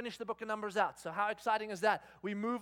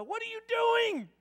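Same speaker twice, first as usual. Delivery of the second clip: loud, high, drawn-out outburst; mock furious scolding; 14 semitones higher, 8 dB louder, slower than usual. Dad to daughter once out the door, mock furious yell. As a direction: explode at the child in exasperation.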